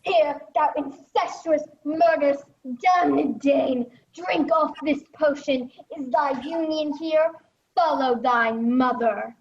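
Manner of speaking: loud, seething condemnation; furious accusation. Acted. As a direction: spit the insults at the king. Hamlet forces the poison.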